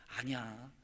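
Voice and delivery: low, soft contradiction; gentle reassurance. Acted. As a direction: quietly brush the self-doubt aside. God gently contradicting Gideon's self-doubt. (낮고 부드럽게 달래며)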